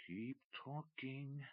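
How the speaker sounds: soft, slow silent urge; silent urging. Acted. Rue silently urging McCracken on, soft and slow. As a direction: breathe it as an inner plea, very slowly. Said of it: "urged Rue silently".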